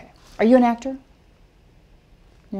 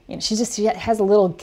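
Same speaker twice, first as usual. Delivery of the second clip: ordinary talk, nothing marked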